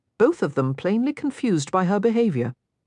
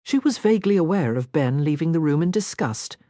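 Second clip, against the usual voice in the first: plain reading voice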